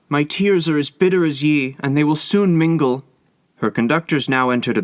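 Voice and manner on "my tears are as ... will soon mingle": grieving cry. A narrator's reading. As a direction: pour out her grief to the sea. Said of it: "she cried".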